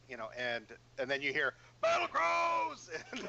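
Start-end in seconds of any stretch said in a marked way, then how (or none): 1.8-2.9 drawn-out high shouted war cry; loud battle cry. Retold or imitated. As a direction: bellow the battle cry, stretched long. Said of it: "I shout battle throws".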